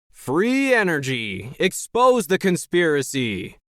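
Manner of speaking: high, slow, stretched-out slogan yell; rallying yell. A narrator's reading. Jerry on stage with a mic, yelling to supporters. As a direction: yell it to rouse a crowd. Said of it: "Jerry yelled".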